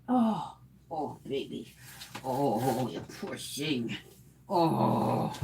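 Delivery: soft, slow pet sympathy; soft cooing sympathy. Real, not acted. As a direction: coo softly at the poor dog. Soothing the itchy dog.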